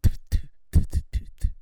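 loud imitated spitting bursts; sharp voiceless mock spits; toddler fake spitting. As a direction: spit drily like a toddler who can't. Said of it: "you couldn't actually spit. I just went".

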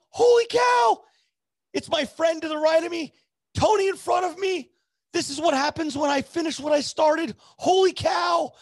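high, loud excited shouting; ecstatic amazement. Retold or imitated. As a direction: yell it out, thrilled beyond belief. Amazed at his luck, retold.

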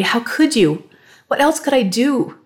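high, loud and accusing; angry. A narrator's reading. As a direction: demand an answer in fury. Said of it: "now she was angry".

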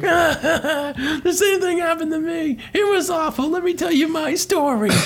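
very high, hammed-up crying voice; mock wailing. Luigi begs to tell his story, hammed-up wail. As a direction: sob it out like a cartoon crybaby. Luigi tearfully joins the therapy session.

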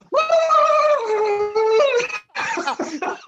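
high squeaky nervous giggle-squawk; awkward turkey impression. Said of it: "You're in".

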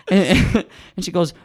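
ordinary talk, nothing marked

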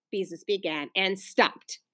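ordinary delivery

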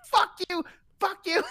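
squeaky high-pitched mock rant; high mock imitation of the caller. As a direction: mimic him in a shrill mocking squeal. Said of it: "He was like".

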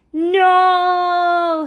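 high, loud cry. A narrator's reading. Logan running at the wolf, high loud cry. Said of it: "he cried".

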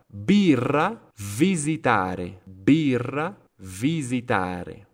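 emphatic alternating pairs, popped B in Base, buzzed V in valle; B versus V. Shown as a demonstration. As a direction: drill B words like Birra against V words like visitare. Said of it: "notan la diferencia de B y V".

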